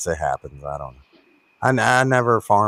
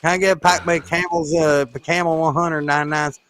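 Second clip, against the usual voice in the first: put-on Kentucky accent; 6 semitones higher than usual. Asking for a pack of Camels in Kentucky, mock Kentucky twang. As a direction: imitate your own old Kentucky accent. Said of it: "I was talking like this".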